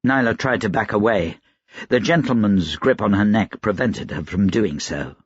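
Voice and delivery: ordinary delivery, nothing marked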